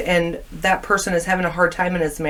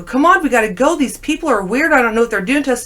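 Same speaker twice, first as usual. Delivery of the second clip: urgent plea; 6 semitones higher, 4 dB louder, faster than usual. Retold or imitated. Urging husband to leave.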